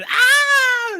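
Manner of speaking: a sudden shrill mock scream, very high; squeaky cartoon shriek of rage; imitated scream. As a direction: mimic her screaming in a comic squeal. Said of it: "starts screaming".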